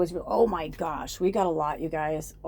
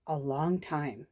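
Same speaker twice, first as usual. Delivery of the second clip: normal talking voice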